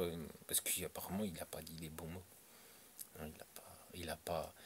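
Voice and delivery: hushed confiding aside; whispering. Real, not acted. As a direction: drop to a whisper.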